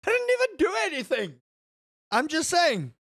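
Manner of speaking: high, fast laughing innocence; laughing protest. Real, not acted. Protesting innocence to chat, laughing high.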